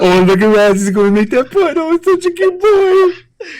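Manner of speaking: high, loud cooing, slowing at the end; mock baby talk. Teasing Raz at golf with high cooing baby talk. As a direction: gush over him like a toddler. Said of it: "I'm like".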